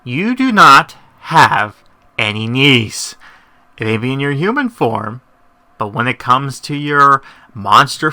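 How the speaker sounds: loud, slow, mocking correction; exasperated rant. Real, not acted. Vlogger scolding the story's kneeling serpent, loud. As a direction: hammer the point home, exasperated.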